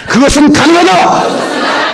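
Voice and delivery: high, drawn-out chant shout; full-out congregational declaration, very high; bigger rallying cry. Real, not acted. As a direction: cry it out slowly at the top of the range. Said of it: "더 크게".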